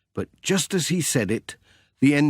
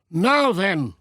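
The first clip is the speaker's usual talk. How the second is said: high, slow, hearty country farmer; cheerful workman warning the postman back; Peter's voice; 8 semitones higher than usual. Acted. Farmer telling Pat to stand back, high and hearty. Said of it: "he said".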